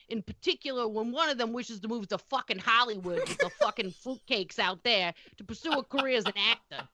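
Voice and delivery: put-on light Boston accent, swearing as she reads. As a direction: reads the stats as a cranky Boston local. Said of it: "in the voice of a heavy Boston accent".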